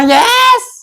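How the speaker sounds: yelped high "yes" of triumph; a quick victory squeal; excited yes. Acted. Son celebrates permission. (ख़ुशी से चीखकर "yes")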